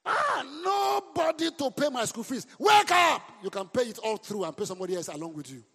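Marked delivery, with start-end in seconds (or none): 0.0-2.5 shouted, slow, high mock wail; a hammed-up shouted complaint; mock lament. Mocking a complaining student. 2.6-3.2 a sharp high shout; a loud rousing cry. Real, not acted. Preacher rousing the congregation.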